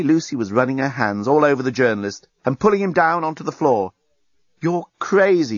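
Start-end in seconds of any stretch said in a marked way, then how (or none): none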